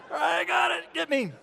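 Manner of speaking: high, shouted tag-team call; hammed-up tag-team yell; mock wrestling shout. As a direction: holler like a wrestler tagging in. Tag-team wrestling handoff joke.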